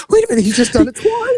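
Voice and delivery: high, quick indignant squawk; mock incredulous outrage. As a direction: protest fast in a very high voice. Acted disbelief at repeated insult.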